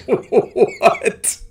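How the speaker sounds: loud, high "what?" through a laugh; mock-baffled high outburst; retold incredulous what. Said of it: "I was like".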